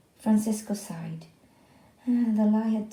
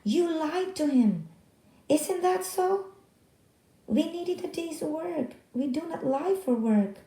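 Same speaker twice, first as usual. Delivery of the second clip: angry scolding; 8 semitones higher than usual. Acted. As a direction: scold the boy for lying. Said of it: "His Spanish was fast and angry".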